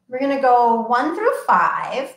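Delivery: plain reading voice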